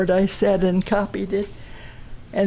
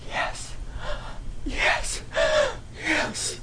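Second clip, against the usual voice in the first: faint whispered yes from a dying man; weak whisper; 12 semitones higher, 5 dB quieter, much slower than usual. Retold or imitated. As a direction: barely breathe the words. Dying father whispers consent.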